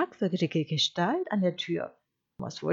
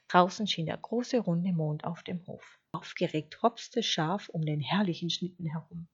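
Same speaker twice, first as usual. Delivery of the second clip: ordinary delivery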